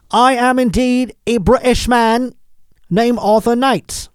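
put-on dollar-store British accent, high and slow; loud, drawn-out bad British impersonation; Arthur Knight. Mocking a fugitive's TV interview in a cheap British accent. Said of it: "a dollar store British accent".

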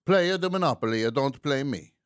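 high, loud hammed-up Italian accent. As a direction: quote the islander's grand phrase in Italian style. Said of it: "this Italian accent".

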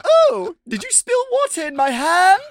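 a startled woman squeals her question; patient on the table. Retold or imitated. Retelling the brain surgery sketch, a high startled patient. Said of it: "And she's like".

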